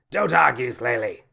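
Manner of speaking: loud, curt rebuke; captain cutting off Flaley's objection, spitting it out; spat. A narrator's reading. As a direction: snap it out to end the argument. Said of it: "the captain spat".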